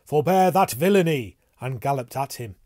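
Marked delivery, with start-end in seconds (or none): 0.0-1.4 loud, high shout to stop; commanding cry. A narrator's reading. Tristram sees his companion trampled, crying out a command. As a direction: call out a forceful rebuke. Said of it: "he cried".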